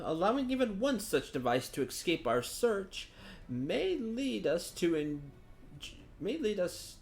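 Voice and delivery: a hint of a theatrical, measured adviser; composed scout briefing. Voices Thancred briefing the others.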